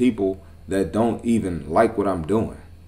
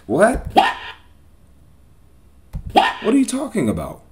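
incredulous what; 10 semitones higher than usual. Real, not acted. Outraged reaction to weight-loss advice.

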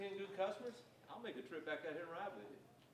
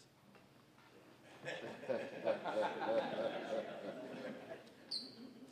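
laughter; 7 semitones lower than usual. Real, not acted. Audience laughing at start.

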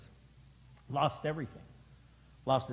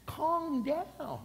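Calm pitched up high and drawn out; higher calm down; 13 semitones higher than usual. Shown as a demonstration. Pastor soothing an imagined member, Calm high. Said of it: "Oh my gosh".

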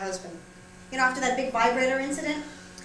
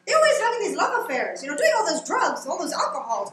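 higher-pitched mock scolding mother; lecturing mother; 6 semitones higher, 6 dB louder than usual. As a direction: imitate a scandalized mother's lecture, pitch easing down. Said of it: "give me these lectures".

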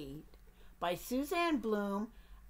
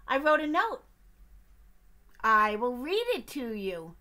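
eager duck; 2 semitones higher, 8 dB louder than usual. Acted. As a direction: pester the bear as a nosy duck. Duck's voice.

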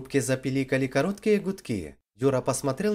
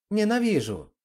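angry; 7 semitones higher than usual. A narrator's reading. Slapping him after the insult.

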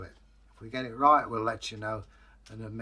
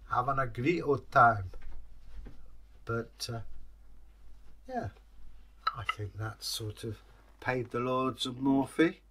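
usual speaking voice

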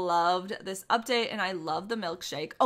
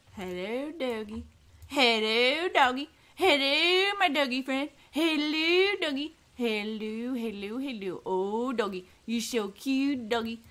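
singsong cooing at a dog; 2 semitones higher than usual. Real, not acted. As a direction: coo at the puppy.

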